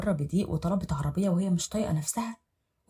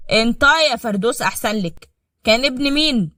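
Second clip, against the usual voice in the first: loud raised husband yelling; furious accusing; 5 semitones higher, 10 dB louder than usual. Acted. Husband beating wife, yelling accusations. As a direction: demand she speak up. Salem threatening.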